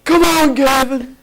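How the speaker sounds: high-pitched mock yelling; Aaron Evans. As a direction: yell in exasperation, voice pushed way up high. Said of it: "him yelling at Gavin".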